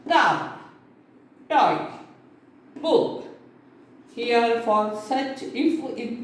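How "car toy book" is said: "car", "toy", "book" each said in one beat; each word a single unbroken unit, set apart by pauses; pronounced as one unit. Shown as a demonstration. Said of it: "it is pronounced as a unit".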